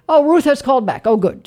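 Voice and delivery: ordinary talk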